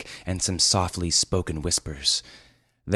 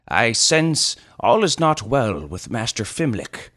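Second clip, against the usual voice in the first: observing sage; 5 semitones higher, 5 dB louder than usual. Acted. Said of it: "observed Eldrador".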